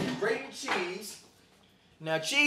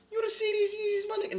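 even higher, shouted squeal; shrieking tease; faster than usual. Acted. Host teases viewers again about his Yeezys, shrieking higher.

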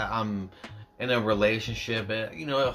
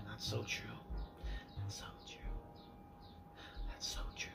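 unvoiced whisper of agreement; 19 dB quieter, faster than usual. Real, not acted. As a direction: whisper it to yourself.

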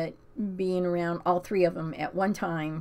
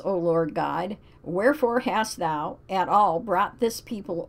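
scripture reading; 2 semitones higher, 5 dB louder than usual. Acted. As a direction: read it like a solemn verse. Reads Bible passage aloud.

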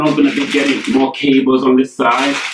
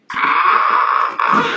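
breathy squeal of delight; unvoiced excited shriek. Real, not acted. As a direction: let out a gasping squeal over the new laptop.

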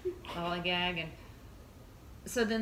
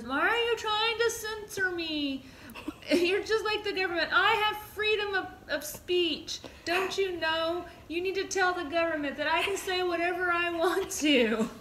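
higher, laughing, indignant ramble; loopy drugged teen; 11 semitones higher, 5 dB louder than usual. Retold or imitated. Mom imitating her daughter high on anesthesia, giggly indignation. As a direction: play the woozy kid protesting her rights.

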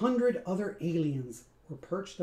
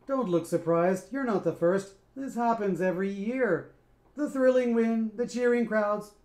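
louder, lightly voiced sneering boss; mocking; 3 semitones higher, 5 dB louder than usual. Scat mocks the band, loud sneering welcome. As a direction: sneer at them with fake welcome. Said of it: "a mocking bow".

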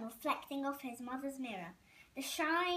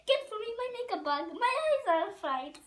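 fast, higher, louder French order, breaking into a laugh; French accent; 9 semitones higher, 6 dB louder, faster than usual. Acted. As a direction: vain French lady barking for her makeup. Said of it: "A, a French accent".

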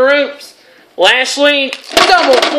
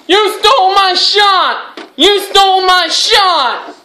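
mock yell as Sting, pitched higher; retold scream; 6 semitones higher than usual. Commentator voicing Sting screaming at the tag team, mock yell. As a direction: yell it like a wrestler who lost his title shot, half-hearted. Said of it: "Sting is screaming".

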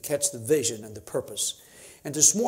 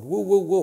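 preacher whoops; 6 semitones higher, 6 dB louder than usual. Real, not acted.

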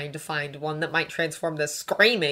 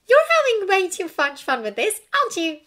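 high, loud, half-hearted accusation; accusing; 7 dB louder than usual. A narrator's reading. Hermione accusing Draco of enjoying it, mock-cross. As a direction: accuse him without real bite. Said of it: "she accused".

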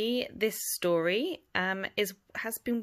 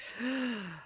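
gasp; 4 semitones higher, 6 dB quieter than usual. A narrator's reading. Reacting to turned page.

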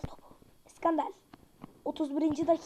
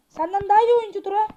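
loud, fast rant at the keeper; angry scolding; 3 semitones higher, 7 dB louder, faster than usual. Real, not acted. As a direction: tell the keeper off hard. Scolding his goalkeeper.

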